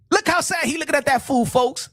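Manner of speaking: ordinary delivery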